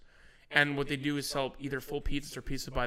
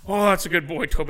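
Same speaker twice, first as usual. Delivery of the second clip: loud, high coaxing dog call; raised-pitch voice for talking to a pet; dog owner; 4 semitones higher, 10 dB louder than usual. Retold or imitated. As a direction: imitate calling and praising a dog. Acted owner coaxing his dog.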